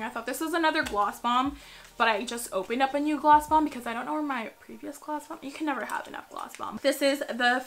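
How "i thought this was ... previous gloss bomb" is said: ordinary talk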